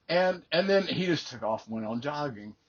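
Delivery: usual speaking voice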